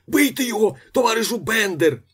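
high, loud urging cry; shouting. A narrator's reading. Choked man urging Bender to attack, shouting. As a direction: yell for help at a high pitch. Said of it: "кричав із землі".

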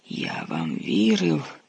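slow, cracking with emotion; words breaking under betrayal. A narrator's reading. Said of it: "Його голос зривався від хвилювання".

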